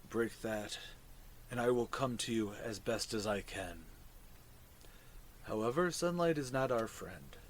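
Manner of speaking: low, soft fungal creature voice; hushed low mushroom speech. Acted.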